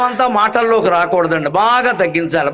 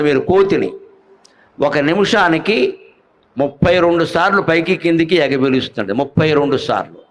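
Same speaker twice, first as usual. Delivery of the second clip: normal talking voice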